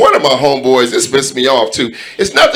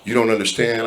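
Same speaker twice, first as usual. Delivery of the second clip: quiet, low retelling of a man about to cry; near tears; 4 semitones lower, 9 dB quieter than usual. Retold or imitated. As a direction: imitate someone holding back tears, softly. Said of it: "about to start crying".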